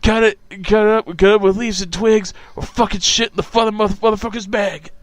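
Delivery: rambling, foul-mouthed character impression; Jane Hughes impression. Co-host performing a movie scene for his friend, character impression. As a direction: imitate a movie character's halting riff. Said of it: "pretty good impression of Jane Hughes".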